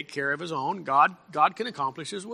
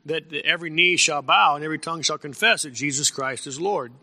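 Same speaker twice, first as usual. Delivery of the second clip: ordinary talk, nothing marked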